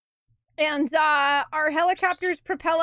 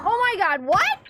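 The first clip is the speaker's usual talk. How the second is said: normal talking voice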